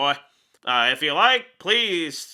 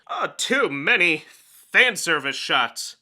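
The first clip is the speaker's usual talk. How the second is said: normal talking voice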